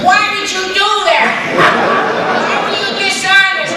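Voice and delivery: high, loud mock yelling; outraged confrontation. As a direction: demand an answer at a hammed-up shout. Acting out an angry confrontation.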